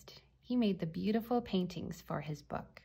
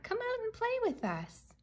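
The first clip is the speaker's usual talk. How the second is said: put-on very high, eager child's call. A narrator's reading. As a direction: call friends out to play in a high voice. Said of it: "called Mac".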